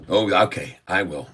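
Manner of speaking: ordinary talk, nothing marked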